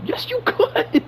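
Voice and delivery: exasperated, voice leaping up; incredulous outburst. Real, not acted. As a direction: pitch jumps high in disbelief. Exasperated retort to the movie.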